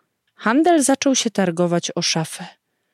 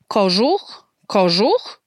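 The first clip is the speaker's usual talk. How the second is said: "Kożuch, kożuch" echoed, each rising at the end; echoed question; slower than usual. A narrator's reading. Father echoes mother's question, "Kożuch, kożuch" with rising tune. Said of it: "powtórzył ojciec".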